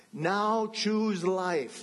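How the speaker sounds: slow, stress "choose" hard with a falling tune; emphatic underlining. Acted. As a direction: underline every word, very slowly. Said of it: "That was my emphasis there, that underlining".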